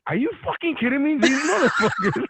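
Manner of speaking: high, incredulous mock outrage; exasperated executive. Retelling the executive's reaction, mock incredulous outrage. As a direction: play the fed-up executive in disbelief. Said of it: "he was like".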